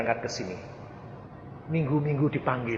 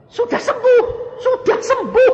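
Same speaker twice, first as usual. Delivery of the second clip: very high, loud exultant cry; triumphant; 9 dB louder than usual. Real, not acted. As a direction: proclaim the healing. Joyful announcement of healing. (seruan gembira, suara sangat tinggi)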